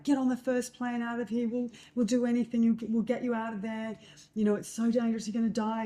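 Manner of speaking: urgent pleading, as retold from frantic parents; imitating hysterical parents. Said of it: "quite hysterical".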